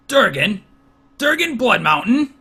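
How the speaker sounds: loud, high calling across a crowd; old friend calling from across the room. Acted. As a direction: call his name over the crowd.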